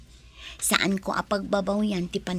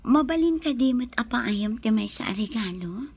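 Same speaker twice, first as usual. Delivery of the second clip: whisper. Acted. Whispering tenderly to partner.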